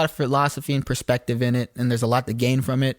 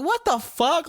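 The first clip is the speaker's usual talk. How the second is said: much higher, stunned "what the"; retold baffled outburst, pitched way up; mock disbelief; 14 semitones higher, slower than usual. He reacts to strangers yelling after his crash, high mock disbelief. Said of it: "I'm like".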